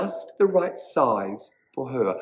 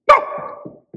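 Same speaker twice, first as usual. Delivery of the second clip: sharp gasped "oh"; shocked gasp; 4 dB louder than usual. A narrator's reading. Witch realizes she forgot the window, sharp gasp. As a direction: draw a sudden shocked breath.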